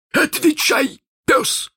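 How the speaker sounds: high, harsh captain's order; angry order. Acted. Hook orders Chako to answer, high and harsh. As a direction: bark at the cowering pirate.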